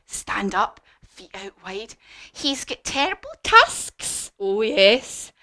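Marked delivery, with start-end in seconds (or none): 2.3-4.3 high, loud storybook mouse; big, high-pitched dramatic description; mouse describing a monster. Acted. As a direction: build up the monster for children. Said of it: "And the mouse said".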